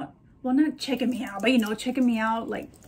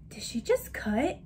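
whisper; 5 dB quieter than usual. Retold or imitated. Inner thought, unvoiced.

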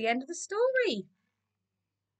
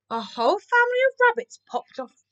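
tag; 11 semitones higher, 3 dB louder than usual. A narrator's reading. Narrator reveals magical surprise.

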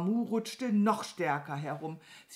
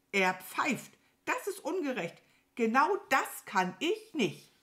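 high, aggrieved crow voice; indignant envy; 8 semitones higher than usual. Acted. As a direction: protest the injustice as a jealous crow. Crow jealous of farmer's whistling. (empört und neidisch klagen)

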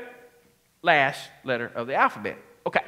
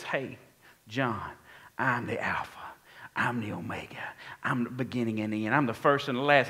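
low, softly spoken Jesus; hushed, low-pitched divine introduction; voicing Jesus; 7 semitones lower, 3 dB quieter than usual. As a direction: quote Jesus in a lower, quieter voice. Voicing Jesus speaking to John.

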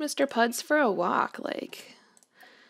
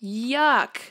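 mock yuck; 4 dB louder than usual. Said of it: "I love it".

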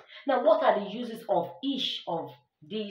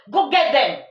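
louder, higher "Go get them"; rallying exclamation; 4 semitones higher, 8 dB louder than usual. Shown as a demonstration. Teacher demonstrates an exclamation, loud rallying call. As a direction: urge them on loudly. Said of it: "apply your exclamation mark".

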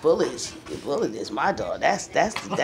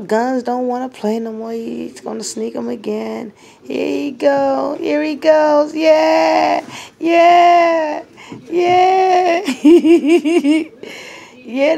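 much higher, louder squeaky puppy voice; playful puppy voice; 11 semitones higher, 7 dB louder, slower than usual. Pretend. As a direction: play the excited little dog taunting its buddy. Owner voices dog playfully.

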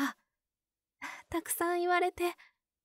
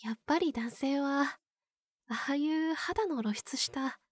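usual speaking voice

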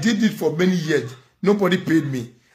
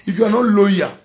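heated outburst; 6 semitones higher, 7 dB louder than usual. Real, not acted. Lecturing women about dignity.

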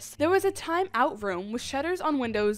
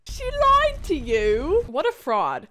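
high, loud, slow shocked gasp of a question; mock outrage at a cousin's lie; 4 semitones higher, 6 dB louder, slower than usual. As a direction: stretch it out in mock disbelief.

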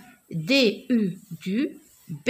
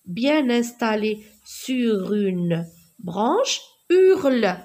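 usual speaking voice

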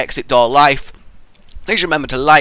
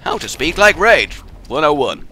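usual speaking voice

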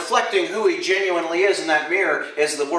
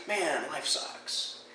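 glum honest admission; 4 semitones lower, 10 dB quieter than usual. Pretend. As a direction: drag it out, worn down. Honest answer voiced.

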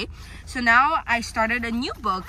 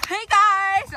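excited; 8 semitones higher than usual. Real, not acted. Greeting camera, excited.

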